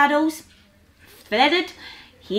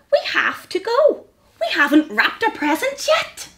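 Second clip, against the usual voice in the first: small mouse voice; 7 semitones higher than usual. Acted.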